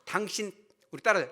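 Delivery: ordinary delivery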